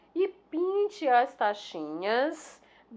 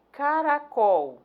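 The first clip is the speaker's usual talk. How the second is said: "caracol" drawn out, loud on "col"; stress on last syllable; 5 semitones higher, 7 dB louder, much slower than usual. Shown as a demonstration. Teacher showing the tonic syllable, "caracol" stressed on "col". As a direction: stretch "caracol" slowly, landing hard on "col". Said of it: "sílaba tônica, col".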